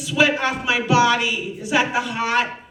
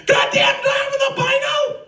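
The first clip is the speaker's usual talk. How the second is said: much higher, louder, fast outburst; shocked shout; 13 semitones higher, 4 dB louder, faster than usual. Retold or imitated. New mother seeing her baby, loud shocked outburst. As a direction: blurt it in shocked alarm. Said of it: "I was like".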